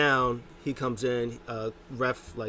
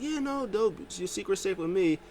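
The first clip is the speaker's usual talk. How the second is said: much higher, lazy stoned voice; stoned yes-man voice; 8 semitones higher than usual. Retold or imitated. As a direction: agree like a stoned buddy, pitched up. Stoned wrestler agreeing.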